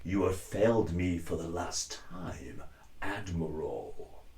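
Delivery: cold and in control, stretched out slowly; Vader voice. Shown as a demonstration. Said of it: "help with your Vader".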